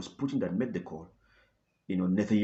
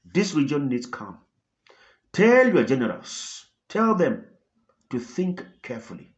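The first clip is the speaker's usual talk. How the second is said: blunt reminder; 4 semitones higher, 6 dB louder than usual. Retold or imitated. Russian president ending the call, blunt warning. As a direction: lay down the warning plainly. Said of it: "a blunt reminder".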